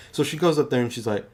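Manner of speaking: ordinary talk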